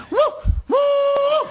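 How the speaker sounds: high falsetto hooting woo calls; loud high-pitched call into the night; bunyip mating call. Retold or imitated. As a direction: hoot it like an animal calling in the bush. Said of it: "I did it again".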